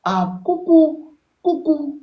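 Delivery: very high, slow, sing-song coucou; mock girlish coucou. Teaching him to answer her text, a drawn-out girly coucou. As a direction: coo it like a sweet girl greeting.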